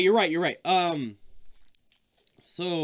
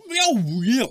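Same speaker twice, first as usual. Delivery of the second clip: garbled babble; 8 semitones higher, 6 dB louder than usual. Retold or imitated. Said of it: "it's like".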